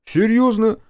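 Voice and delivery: high, loud, astonished query; incredulous question. A narrator's reading. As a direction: ask in loud, high-pitched disbelief. Удивлённый переспрос в споре. (громкое удивление высоким голосом)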